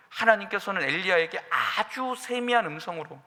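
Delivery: ordinary talk, nothing marked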